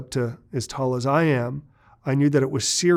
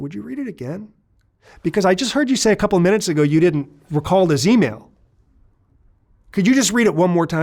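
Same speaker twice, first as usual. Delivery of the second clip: mock-polite, higher and louder; playful taunting; 6 semitones higher, 6 dB louder than usual. Agent teasing Justin after catching his lie, playful taunt. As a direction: toy with him like a cat with a mouse. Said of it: "He's kind of playing with me".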